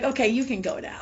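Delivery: light, laughing send-off; laughing dismissal. Retold or imitated. Woman laughing as she tells her ex to leave. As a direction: laugh as you shoo him out. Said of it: "And then I'm like".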